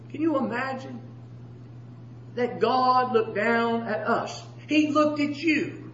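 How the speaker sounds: soft, slow pulpit wonder; quietly awed preacher; hushed preaching. Acted. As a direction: bring the sermon down to a hush, with long pauses.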